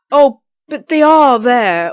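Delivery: high, slow, whiny protest; a spoiled villain whining she isn't lying. Shown as a demonstration. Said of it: "She'll be going".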